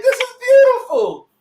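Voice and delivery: very high, loud imitation of gushing guests; mock gushing. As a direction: gush it in a mocking high voice. Mimics gushing wedding guests.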